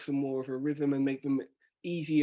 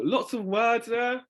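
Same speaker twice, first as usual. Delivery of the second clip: usual speaking voice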